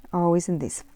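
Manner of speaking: ordinary talk, nothing marked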